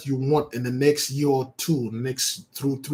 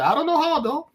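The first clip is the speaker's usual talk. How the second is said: bewildered; 13 semitones higher than usual. Real, not acted. High exclaim.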